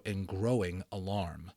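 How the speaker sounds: usual speaking voice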